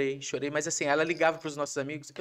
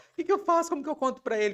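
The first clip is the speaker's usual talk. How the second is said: much higher, louder voice of a flustered wife; mimicking anxious wife; 12 semitones higher, 7 dB louder, faster than usual. Husband mimicking his wife asking friends for help, high and flustered. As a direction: squeal it like a panicked friend. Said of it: "ligou pras nossas amigas".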